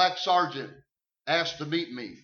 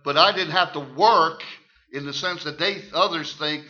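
normal talking voice

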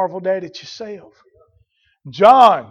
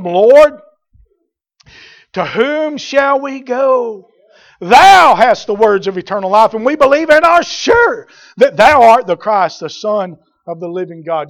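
fervent scripture reading; 3 semitones higher, 8 dB louder than usual. Acted. Reads Peter's answer with force.

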